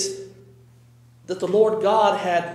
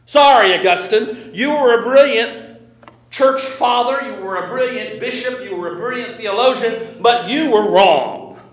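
forceful preaching; 4 semitones higher, 6 dB louder than usual. Acted. Preacher addresses Augustine, rising force.

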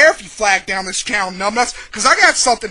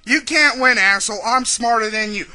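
ordinary talk, nothing marked

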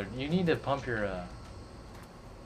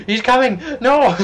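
loud, high, panicked yell; panicked shout; 12 semitones higher, 16 dB louder, slower than usual. Real, not acted. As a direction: shout a warning as it charges. Dino charges at them.

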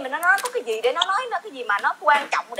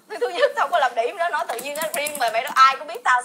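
normal talking voice

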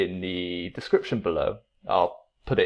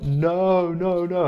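put-on kids shouting no; 10 semitones higher, slower than usual. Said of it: "and they're like".

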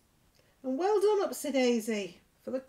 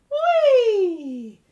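slow, much louder laughing "wee"; excited wee; 10 semitones higher, 16 dB louder than usual. Acted. Teacher giving a big laughing wee to toddlers. Mouse slides down in the song.

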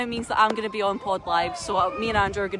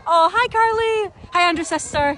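excited greeting; 9 semitones higher, 5 dB louder than usual. Real, not acted. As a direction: greet her like a long-lost friend. Greeting host's sister excitedly.

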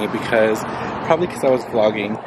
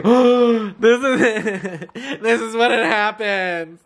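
panicked laughing; 8 semitones higher, slower than usual. Retold or imitated. Panicked quote during police stop.

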